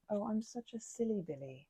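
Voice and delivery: ordinary delivery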